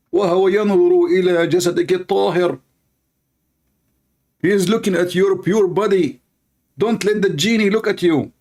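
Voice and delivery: high, urgent, fast scolding cleric voice; hammed-up alarm, warning the sister about the fish; frantic sheikh. Mocking a sheikh warning a caller about the genie fish, in a high frantic voice.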